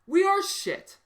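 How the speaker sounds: yelled real anger; angry shout. Real, not acted. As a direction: shout it in disgust. Angry climax of rant.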